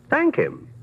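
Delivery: high, sneering order; a taunting demand, pitched high; mocking command. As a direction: force him to say it, amused. Host forces attorney.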